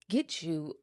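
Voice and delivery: ordinary talk, nothing marked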